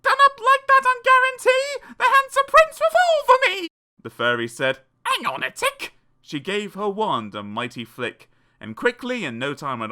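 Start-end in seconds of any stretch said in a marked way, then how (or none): 0.0-3.7 high-pitched screeching tantrum; very loud, very high hammed-up shouting; shouted. A narrator's reading. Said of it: "and shouted". 5.0-5.9 very high fairy voice; high-pitched fairy interjection; magic fairy. A narrator's reading. Fairy's reply voiced in story.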